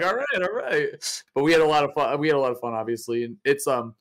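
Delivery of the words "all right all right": slow, high-pitched concession; mock backing off. Retelling his reply to co-caster's comeback, playful backing off. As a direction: give in with drawn-out, playful surrender.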